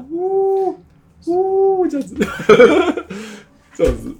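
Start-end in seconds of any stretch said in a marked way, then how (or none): 0.1-1.9 high drawn-out whale hoot; whale call. Retold or imitated. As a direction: sing a high whale moan. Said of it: "你就这样听到".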